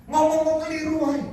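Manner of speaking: jauh lebih tinggi dan keras, menyindir; tegur mengejek. Retold or imitated. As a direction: sindir dengan nada tinggi. Pendeta menegur penelepon.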